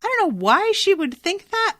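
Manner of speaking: high-pitched disbelief; incredulous. Real, not acted. Podcaster baffled at a character misreading the doctor, incredulous.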